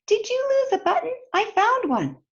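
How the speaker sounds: little sparrow voice. Acted. Sparrow speaks to Toad.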